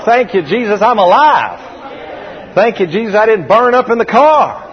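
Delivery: high, forceful cry of gratitude; preacher's acted outburst of thanks; emphatic thanks. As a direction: exclaim it with relief, punching the last words. Acts out thanks for surviving.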